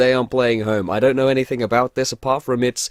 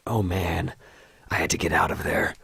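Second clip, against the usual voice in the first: American narrator, low and hushed; put-on American accent; 6 semitones lower, 5 dB quieter than usual. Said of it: "what kind of accent I'm doing".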